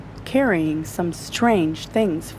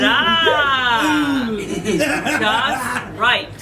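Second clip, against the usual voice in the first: doctor; 3 semitones higher, much slower than usual. Acted. Doctor finds right needle.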